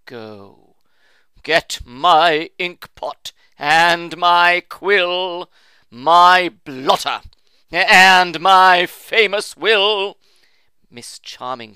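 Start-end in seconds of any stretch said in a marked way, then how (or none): 0.1-10.2 loud, slow, furious orders; furious old uncle. A narrator's reading. Wounded uncle ordering the nurse to fetch his will. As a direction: bark out each demand in a rage.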